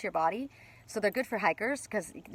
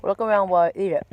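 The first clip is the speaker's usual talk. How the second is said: mouth full; 2 semitones lower, 4 dB louder than usual. Real, not acted. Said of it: "My mouth full".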